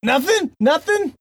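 high, rising disbelief; can't believe nobody answers; incredulous. Real, not acted. Incredulous at silence.

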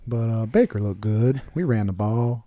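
a hint of a wet-blanket coach mumbling; mock coach droning. Podcaster mocking the Browns coach, slow flat drawl. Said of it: "He's like".

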